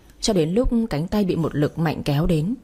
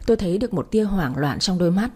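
usual speaking voice

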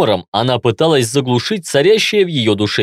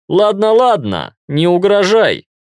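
playful, higher-pitched, slow placating reply; joking; 7 semitones higher than usual. A narrator's reading. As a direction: give in with mock surrender. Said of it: "шутливо ответил я".

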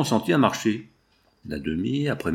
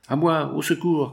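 higher call for help; cry for help; 5 semitones higher than usual. A narrator's reading. Engineer cries for help facing the foreman's knife. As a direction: shout for rescue facing the knife. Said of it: "cria l'ingénieur".

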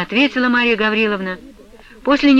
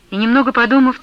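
plain reading voice

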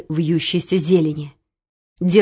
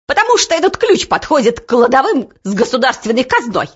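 higher-pitched squeal of accusation; squeaky outburst; 4 semitones higher, 3 dB louder, faster than usual. A narrator's reading. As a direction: squeak it out indignantly. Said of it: "пропищал".

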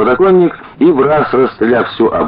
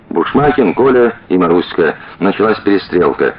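plain reading voice

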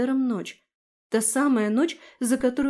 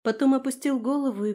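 plain reading voice

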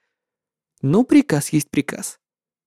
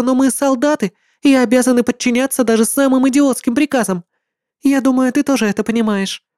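put-on higher, encouraging girl's voice; young woman; 7 semitones higher than usual. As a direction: supportive female comrade. Tong Xin encourages.

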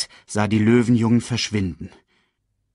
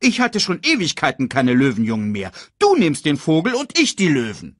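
bickering hyena, voice climbing higher; heated, raised-pitch squabbling; angry scolding; 5 semitones higher, 4 dB louder than usual. A narrator's reading. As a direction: scold and stake your claim. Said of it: "schimpfte Banzai".

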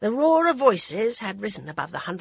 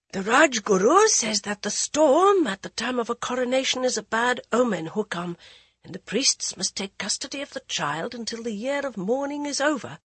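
higher, deferential minister; courtier's voice; 5 semitones higher than usual. A narrator's reading. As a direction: report it humbly to the queen. A council minister speaks.

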